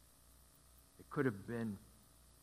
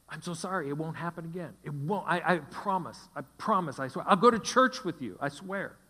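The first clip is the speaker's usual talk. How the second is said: higher, louder, frantic promises; begging husband; 5 semitones higher, 8 dB louder than usual. Retold or imitated. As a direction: beg and promise, voice rising higher. Husband begging her to stay.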